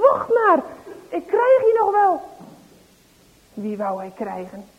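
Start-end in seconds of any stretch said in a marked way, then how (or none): 0.0-2.2 higher and louder shouted threat; angry threat. Acted. Farmer shouting after the fleeing boys, angry. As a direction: shake your fist and shout after them. Farmer shaking fist at thieves.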